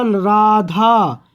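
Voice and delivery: राधा with रा stretched, as if calling someone; slow calling राधा with long रा; called-out Radha. Shown as a demonstration. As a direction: call out राधा, holding the रा. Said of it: "आवाज़ तेज़ी से लेना पड़ा".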